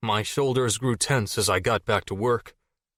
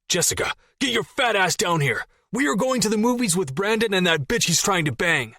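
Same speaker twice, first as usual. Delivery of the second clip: yelling; 10 semitones higher than usual. Acted. Jason yelling up stairs.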